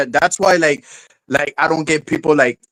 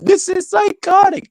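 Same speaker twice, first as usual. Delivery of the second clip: high, squeaky mock of her complaint; hammed-up high imitation of a crying girl; mock high-pitched quote. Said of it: "when she was saying".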